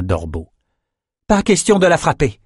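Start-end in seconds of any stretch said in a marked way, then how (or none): 1.3-2.4 high-pitched firm order; raised-pitch, insistent command. A narrator's reading. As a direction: forbid it with authority. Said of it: "ordonna Nietzsche".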